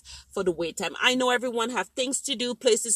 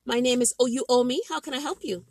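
higher, louder, bright-on-cue call center greeting; chirpy service greeting; 6 semitones higher, 3 dB louder than usual. Acted. Call center rep greeting a waiting caller, chirpy and higher. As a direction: sell the fake-friendly hello.

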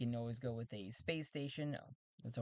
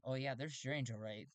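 ordinary talk, nothing marked

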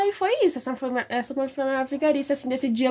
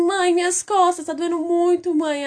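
mock fake crying; 6 semitones higher, slower than usual. Girl faking a fall to skip school, hammed-up whimper. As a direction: play the kid faking pain for mom. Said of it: "E chorando, chorando, chorando".

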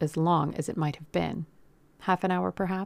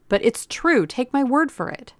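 higher, louder protest of innocence; insistent plea; 7 semitones higher, 6 dB louder than usual. A narrator's reading. Captive pleading with the gunman, insistent. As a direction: push hard to be believed.

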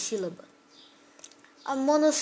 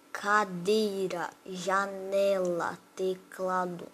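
each word drawn out syllable by syllable; slow sounding-out; 3 semitones lower, much slower than usual. Shown as a demonstration. Teacher giving paroxytone examples, slow and syllable by syllable. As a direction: stretch every syllable, pausing between words.

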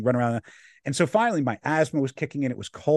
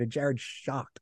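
normal talking voice

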